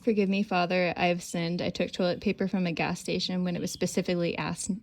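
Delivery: loud, lively read-aloud of a log entry. Retold or imitated. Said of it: "reading the logbook".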